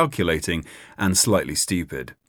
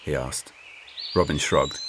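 ordinary delivery

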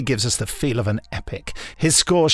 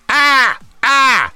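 pterodactyl screech; 12 semitones higher, 8 dB louder than usual. Retold or imitated. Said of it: "they're sort of".

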